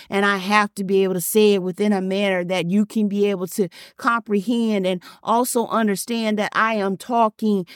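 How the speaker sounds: normal talking voice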